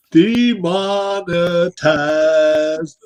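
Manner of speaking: drawn out. Acted. Joking drawn-out word.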